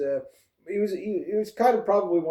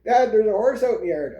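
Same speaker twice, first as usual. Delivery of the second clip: put-on higher, louder kid calling out to his dad; 8 semitones higher, 5 dB louder than usual. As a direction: call out to Dad like a kid spotting something exciting.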